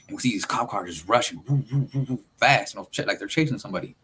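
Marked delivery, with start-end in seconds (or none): 1.5-2.2 high wooing siren imitation; imitated siren. Storyteller imitating cop cars rushing past, siren wooing. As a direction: woo like a passing police siren.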